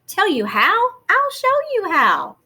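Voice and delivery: lifted, playful dad voice rising high on show; a dad who can't wait to reveal his secret; dad's eager reply. Acted. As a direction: tease the kid with a grand promise. Dad playfully answers son's request.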